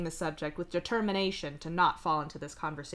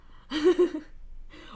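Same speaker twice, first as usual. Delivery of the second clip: laugh; 9 semitones higher, 4 dB louder than usual. Real, not acted. Laughing at the situation.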